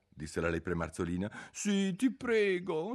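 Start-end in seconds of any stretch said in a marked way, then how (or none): 1.5-2.9 high, slow begging voice; Alice pleading with the dormouse for a story. A narrator's reading. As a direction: beg sweetly, drawing it out. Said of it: "supplicò Alice".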